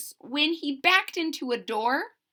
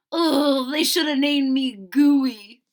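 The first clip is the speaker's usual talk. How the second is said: sighing; 3 semitones higher, 7 dB louder than usual. Acted. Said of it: "he sighed".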